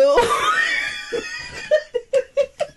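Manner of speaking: high shrieking laugh; helpless laughter. Real, not acted. After singing co-host's reply, helpless laughter. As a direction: crack up completely, pitch shooting up. Cracks up at own impression.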